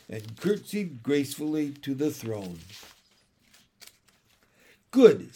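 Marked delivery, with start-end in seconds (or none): 4.9-5.3 pleased. A narrator's reading. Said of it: "said the king pleasantly".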